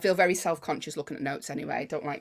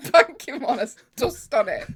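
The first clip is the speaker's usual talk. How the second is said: louder, higher, slow through a laugh; 6 semitones higher, 8 dB louder than usual. Real, not acted. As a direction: tease while cracking up. Joking with laughter.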